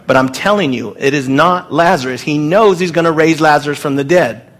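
ordinary talk, nothing marked

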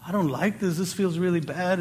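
dejected. Retold or imitated. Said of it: "I start walking away all sad".

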